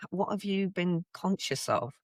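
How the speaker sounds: ordinary delivery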